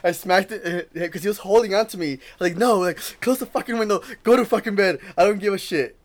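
high, loud frantic refusal; loud scared no; panicked yelling. Retold or imitated. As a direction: shout it down in fear. Refusing in panic, smacking friend.

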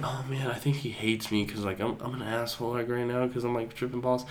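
quietly fretting to oneself; worried inner thought. Retold or imitated. Retelling worried inner thought while tripping.

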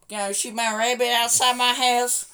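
high, loud, slow mock woman's voice; hamming up the neighbour; quoting a woman. Imitating the woman about rabbits, high, loud and slow.

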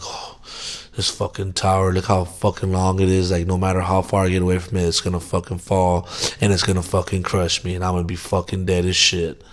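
hushed, low, dread-filled muttering to himself; soft fearful inner monologue; anxious inner thoughts. Retold or imitated. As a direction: let the panic spiral quietly in your head. Imagining the tower falling on him.

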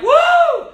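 high-pitched "woo" of release; celebratory whoop. Acted. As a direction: whoop like school just let out. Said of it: "and you're just like".

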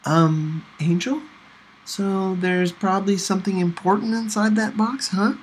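breezy casual. A narrator's reading. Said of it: "I mustered up the breeziest voice I could".

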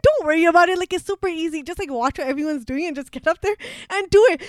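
loud, high, breezy pep talk; acting out an overconfident boss; imitated emphatic reassurance. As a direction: wave the worry away loudly. Imitates boss urging nervous intern.

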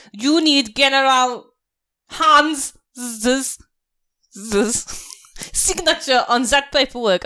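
self-important officer laying down the rules; General Hans. Acted. General demanding his signature on paperwork, officious and threatening.

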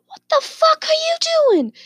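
very high mock screech; high-pitched retold shriek; imitated screaming wife. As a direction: mimic a furious wife screaming at her husband. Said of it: "it starts screaming and bawling".

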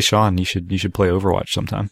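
normal talking voice

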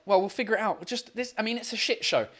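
usual speaking voice

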